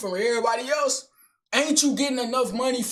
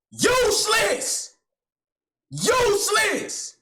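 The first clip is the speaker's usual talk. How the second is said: slow, higher, drawn-out yell of rage; furious yell; 8 semitones higher, slower than usual. Acted. Man screaming at his useless phone in rage.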